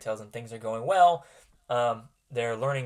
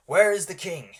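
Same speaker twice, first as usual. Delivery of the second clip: a higher, louder urgent wizard demand; lightly voiced Gandalf; 6 semitones higher, 7 dB louder than usual. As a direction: ask for the king with urgency. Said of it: "He rides up to you and says".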